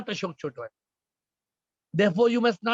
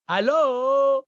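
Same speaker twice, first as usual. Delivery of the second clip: loud, higher drawn-out hello; phone greeting; 5 semitones higher, 5 dB louder than usual. Acted. Teacher mimicking people picking up the phone, exaggerated phone greeting. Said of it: "you pick the phone and you say".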